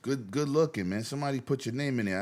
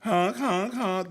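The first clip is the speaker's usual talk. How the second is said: silly clown; 9 semitones higher, much slower than usual. Retold or imitated. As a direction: blast the honks like a cartoon horn.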